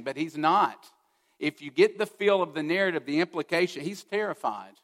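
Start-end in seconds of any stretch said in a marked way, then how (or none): none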